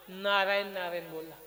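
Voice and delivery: low, subdued reply; low answer. Acted. Preacher answers about the parrot, low answer. Deadpan answer after loud question.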